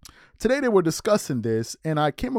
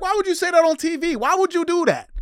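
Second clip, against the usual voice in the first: high-pitched, loud incredulous demand; exasperated outcry; 13 semitones higher, 5 dB louder than usual. Retold or imitated. Reacting to a remark made on TV, high exasperated outcry. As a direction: cry out in high, pleading disbelief.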